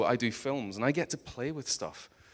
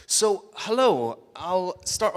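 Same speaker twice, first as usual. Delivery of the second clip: slow, higher, louder hello; big greeting; 8 semitones higher, 7 dB louder, much slower than usual. Acted. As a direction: boom it out to the whole room. Big loud restart greeting.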